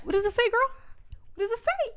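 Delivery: high, singled-out mock questioning; teasing quiz. Reese quizzing Feyre on a note, high teasing voice. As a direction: pitch it way up, goading her.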